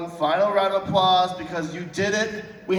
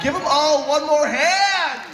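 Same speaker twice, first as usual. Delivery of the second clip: calling out; 5 semitones higher, 3 dB louder, slower than usual. Real, not acted. Host rallying crowd applause.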